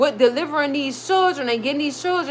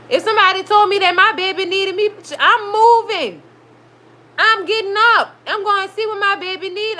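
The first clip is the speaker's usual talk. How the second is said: fired-up rant; 6 semitones higher than usual. Real, not acted. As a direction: preach it with conviction. Passionate rant about the baby.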